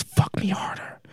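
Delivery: high, hushed imitation of her yell; whispered mock yell. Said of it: "Then she yells".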